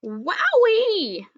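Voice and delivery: high, loud thrilled whoop; excited bark. A narrator's reading. Puppy seeing muffins at the door, excited whoop. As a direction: whoop with doggy joy. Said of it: "I bark".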